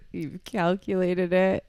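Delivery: slow words through laughter; laughing. Real, not acted. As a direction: laugh while saying it.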